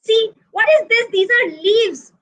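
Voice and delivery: high-pitched accusing shout at a friend; betrayed rabbit shouting at his friend, high and accusing; angry shout. Acted. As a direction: yell that you were cheated. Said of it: "he shouts at Matru".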